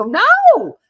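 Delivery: a high, loud no, then settle; insistent exclamation. Retold or imitated. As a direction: protest it's true, high and loud. Insisting reply quoted.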